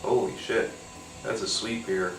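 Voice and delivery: normal talking voice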